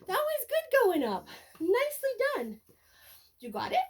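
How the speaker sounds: high, sing-song praise. Real, not acted. Trainer praising her puppy after a calm pickup, high baby talk.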